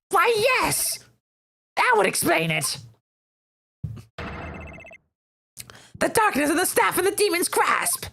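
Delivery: put-on high, theatrical old man; old mayor voice. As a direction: ham up the pompous old mayor.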